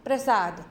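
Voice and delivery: प्रसाद said prasad with s, falling; प्रसाद as the right prasad; correct form. Shown as a demonstration. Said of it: "क्या आएगा?". (सही उच्चारण प्रसाद, स के साथ)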